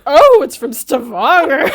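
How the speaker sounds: slow, dry echo, laughing under it; deadpan his flat reply while cracking up; a hint of a bored barman, laughed through; mock unimpressed barman. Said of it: "and then he goes like".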